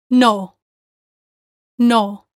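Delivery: NO said alone with a long, strong O; emphasized NO. Shown as a demonstration. Teacher modelling the first word group, emphasized NO. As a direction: hold and hit the word NO. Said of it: "we want to emphasize the word".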